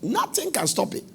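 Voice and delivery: usual speaking voice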